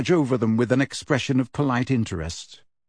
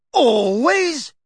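a louder, higher booming single word; 13 semitones higher, 4 dB louder than usual. A narrator's reading. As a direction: bark it out like a stern judge. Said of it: "boomed Madam Bones".

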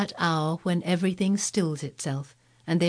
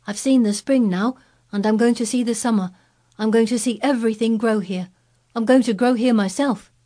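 put-on higher, louder, eager boy; excited boy; 6 semitones higher, 5 dB louder than usual. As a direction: declare it with bright resolve. Colin voiced, determined joy.

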